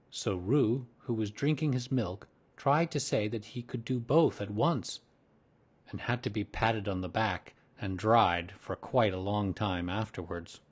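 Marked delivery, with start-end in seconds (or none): none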